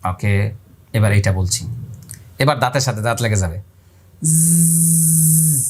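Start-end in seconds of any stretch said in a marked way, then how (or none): 4.3-5.6 long buzzing জ্জ like a cricket. Shown as a demonstration. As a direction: hold a long buzz through the teeth. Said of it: "এই যে একটা আওয়াজ করে নিলাম".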